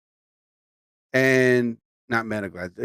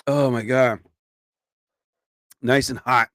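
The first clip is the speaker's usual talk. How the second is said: usual speaking voice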